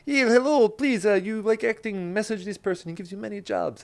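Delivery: high-pitched, hammed-up Russian accent; eager Russian guy, badly faked accent; terrible Russian accent. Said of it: "It's my terrible Russian accent".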